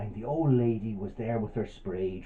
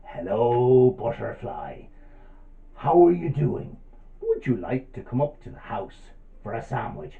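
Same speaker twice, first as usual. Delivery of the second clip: sly spider. Acted. Spider inviting the butterfly up to the house, slyly coaxing voice. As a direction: lure them in with a creepy invitation. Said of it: "he heard a voice".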